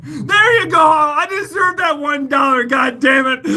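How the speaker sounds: high, loud mock demand for tips, laughing; playful outrage at viewers, shouted high; excited joking shout. Real, not acted. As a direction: shout it like you've been robbed of a dollar.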